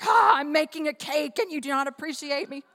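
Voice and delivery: hammed-up indignant Martha; angry Martha. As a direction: sputter like a cook nobody thanks. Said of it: "She's like".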